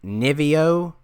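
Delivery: "Nivio" sounded out slowly as a best guess; careful, hesitant attempt at "Nivio"; tentative pronunciation guess. Shown as a demonstration. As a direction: try "Nivio" carefully, unsure of the name. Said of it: "have a hard time pronouncing this".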